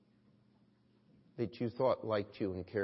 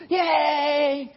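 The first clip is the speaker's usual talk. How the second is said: high, loud mock cheer; 13 dB louder than usual. Pastor showing the congregation the kids' cheer, loud whoop. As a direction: whoop like a kid at the movie. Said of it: "watching one of those things and go".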